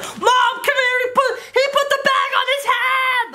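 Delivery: high-pitched excited call to mom; child yelling for mom to come see. Retold or imitated. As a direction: shriek it like a kid calling mom. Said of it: "I was like".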